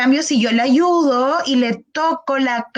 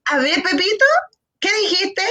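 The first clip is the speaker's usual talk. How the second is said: higher, excited mother praising; mother thrilled her child said "auto", pitched up; excited mom; 7 semitones higher than usual. Retold or imitated. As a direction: pounce happily on the word. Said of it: "la mamá así como".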